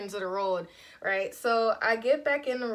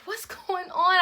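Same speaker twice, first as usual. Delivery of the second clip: panicked cry; 9 semitones higher, 5 dB louder, slower than usual. Retold or imitated. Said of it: "I'm like".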